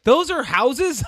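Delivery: mock baffled, slow rise; surprised question. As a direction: act puzzled. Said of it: "she was like".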